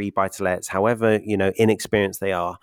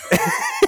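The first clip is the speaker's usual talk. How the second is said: high breathy laugh; 9 semitones higher, 4 dB louder than usual. Real, not acted. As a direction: burst of airy laughter. Laughs at own mix-up.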